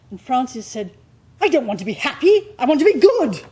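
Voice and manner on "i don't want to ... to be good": higher and louder, fast at first, rising to a cry on good; passionate outburst. Retold or imitated. Francis retorting to the director at rehearsal, passionate outburst. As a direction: fling it back at the director with conviction. Actor's heated reply to director.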